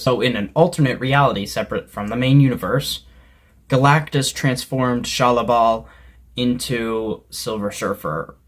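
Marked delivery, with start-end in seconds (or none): none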